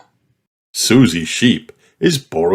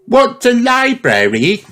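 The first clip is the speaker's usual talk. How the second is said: much higher, small piglet voice; Peppa's little voice. A narrator's reading. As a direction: ask like a curious little girl. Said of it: "asked Peppa".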